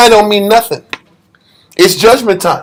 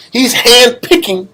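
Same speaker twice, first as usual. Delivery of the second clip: ordinary talk, nothing marked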